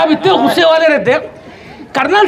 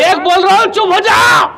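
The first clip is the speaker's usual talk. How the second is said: high, shouted mock warning to the wife; comic officer's shout, pitched right up; hot-tempered colonel; 7 semitones higher than usual. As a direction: bellow the count and order silence. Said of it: "colonel साहब पहले किराक आदमी".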